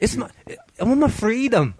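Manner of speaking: high Scottish lilt like Merida from Brave; Merida impression. Shown as a demonstration. Host voicing the girl as Merida from Brave. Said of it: "sound like Merida from Brave".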